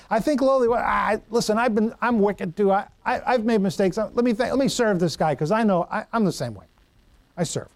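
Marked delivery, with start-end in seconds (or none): none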